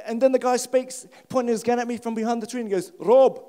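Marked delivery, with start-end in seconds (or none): 3.0-3.4 loud South African call, pitched up; South African cop. Retold or imitated. South African cop calls to the man from behind a tree. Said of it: "He's South African".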